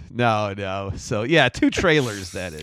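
normal talking voice

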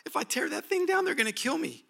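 high, frantic whimpering panic; panicky fear. Retold or imitated. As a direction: squeal in mock terror. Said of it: "he goes back into fear".

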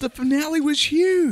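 high, loud protest; insistent exclamation. Real, not acted. Arguing with his co-host that the finale was big, excited. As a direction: push back hard on huge.

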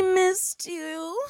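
high, slow sing-song coo. Real, not acted. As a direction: coo it at the listeners. Cooing greeting, high and slow.

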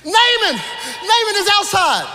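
high, loud, breathless excitement; can't contain the news; excited servant. Retold or imitated. Servant bursting in on Elisha, high excited shouting.

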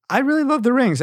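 high, gushing emphasis on love; excited. Real, not acted. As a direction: say it with real delight. Repeated with emphasis.

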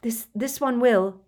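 gasped, urgent reassurance; Harry. A narrator's reading. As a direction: stammer it out in distress. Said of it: "gasped Harry".

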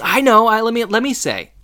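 put-on a kid desperate to be picked; eager student. [name] eagerly volunteering.

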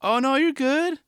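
high, soothing mock parent; reassuring parent. Parent reassuring a fallen kid, high mock soothing. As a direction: coax a fallen kid not to cry. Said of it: "You're like".

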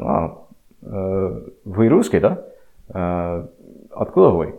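switching into Russian mid-story, lightly; casual Russian questions to a new acquaintance; speaking Russian. Retold or imitated. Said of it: "started talking to him in Russian".

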